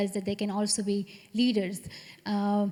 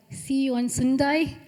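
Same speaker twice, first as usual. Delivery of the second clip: slower, louder Brummie accent; Birmingham accent; 4 semitones higher, 5 dB louder than usual. Shown as a demonstration. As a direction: show off a drawn-out Birmingham accent. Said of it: "Can you do the accent?".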